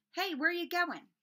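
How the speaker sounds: calling after them in alarm. Acted.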